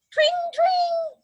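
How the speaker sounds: very high, ringing tring; bell ring. A narrator's reading. Reading to children, a high bell ring. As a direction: sing it like a bicycle bell. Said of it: "bicycle bell ringing".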